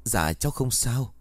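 put-on mildly flustered girl, slightly altered voice; flustered reply. A narrator's reading. Girl answering the elegant woman, mildly flustered. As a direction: answer with a touch of awkwardness. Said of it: "mới luống cuống trả lời".

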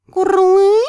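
high questioning creature chirp; high little dragon query. Acted. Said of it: "Драконья морда вытянулась".

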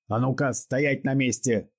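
hoarse, high, slow shout. A narrator's reading. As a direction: rasp out the order hoarsely. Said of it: "крикнул Пугачёв сиплым голосом".

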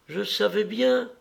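put-on high, drowsy little boy's voice; enfant qui se réveille. A narrator's reading. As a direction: pitch it up like a child just waking. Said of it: "fit-il en trouvrant les yeux". (voix aiguë d'enfant qui s'éveille)

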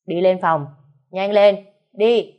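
husband yelling at his wife to go; angry order. Acted. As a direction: bark each word to force her upstairs. Husband drags wife upstairs.

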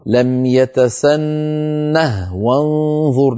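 slow recited لم يتسنه وانظر; وصل مع سكون الهاء; ha kept silent in connection. Shown as a demonstration. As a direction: keep the h of يتسنه still when joining. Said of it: "لو وصلنا".

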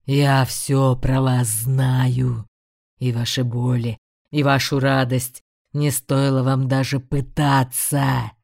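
low, slow menacing demon; a demon gloating over mortals. A narrator's reading. Demon voice continues. (медленный низкий голос демона)

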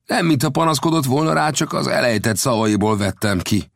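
gambusino's voice. A narrator's reading. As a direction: tell the tale as the friendly gold digger. Gambusino tells Horton's story.

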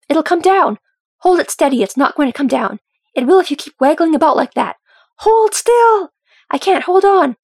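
high, loud shouts of alarm; panicked shouting. A narrator's reading. Workers struggling with a toppling beam, shouting in panic. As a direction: shout over each other as it slips.

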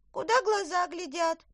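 high, bright little elf; squeaky elf speaking. Acted. Elf answers.

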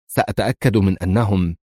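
ordinary delivery, nothing marked

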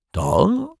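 very high mock-innocent query; feigned innocence. A narrator's reading. Rogue toys with the detective, mock-innocent. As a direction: squeak it up as if clueless.

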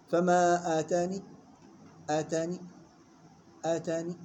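آتاني with a faint partial final "i"; short, softened "ni" closing each آتاني; rawm, two counts. Shown as a demonstration. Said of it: "والروم حركتين". (آتاني بالروم حركتين)

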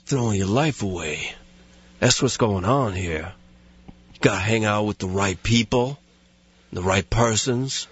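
solemn put-on dialect, words left loose; Steven Seagal impression. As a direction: lecture a kid in a lazy, deadpan voice. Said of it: "starts giving them a lecture".

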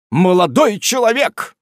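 loud pompous preacher's call. A narrator's reading. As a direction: intone it with self-importance. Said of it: "сказал мистер Стидженс напыщенным тоном".

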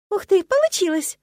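high, loud burst of delight; sincere exclamation. A narrator's reading. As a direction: exclaim in genuine amazement at succeeding. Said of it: "совершенно искренне воскликнула я". (искренне, высоко и громко воскликнуть)

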